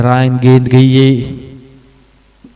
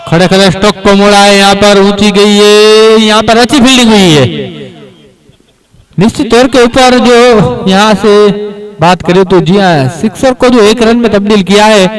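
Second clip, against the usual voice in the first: excited cricket commentary; 7 semitones higher than usual. Acted. Live play-by-play of stroke.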